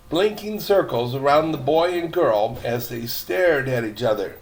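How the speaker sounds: usual speaking voice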